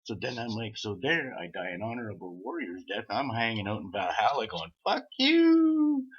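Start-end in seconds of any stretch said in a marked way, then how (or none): 4.8-6.1 high, loud yelled taunt; defiant shout. Retold or imitated. Dead warrior in Valhalla taunting his killers, shouting.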